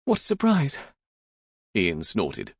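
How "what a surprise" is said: very high, guileless daughter voice; high-pitched exclamation of mock delight; feigned innocent surprise. A narrator's reading. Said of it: "her guileless expression was being undermined".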